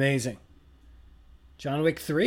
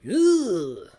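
grunt; 9 semitones higher, 9 dB louder than usual. Retold or imitated. Mimics Denzel grunt.

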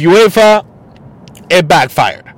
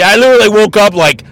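ordinary talk, nothing marked

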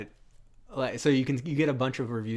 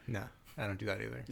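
soft, inflectionless deadpan; quietly monotone, as if incapable of emphasis; David Duchovny; 9 dB quieter than usual. Retold or imitated. Mocking an actor who refuses to whisper for the script, deadpan. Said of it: "everything is deadpan".

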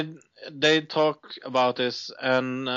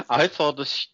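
ordinary talk, nothing marked

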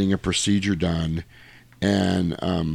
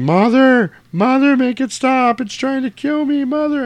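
very high, loud, pleading "Mother" scream; a possessed girl screaming to her mother; imitated scream; 6 dB louder than usual. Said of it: "she's screaming".

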